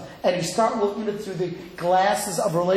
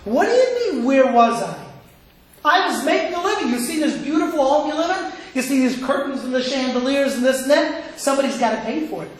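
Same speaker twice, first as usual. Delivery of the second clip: upset husband. Retold or imitated. Husband defending himself to his waiting wife, mock upset. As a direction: act out a husband firing back defensively. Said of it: "he gets all upset".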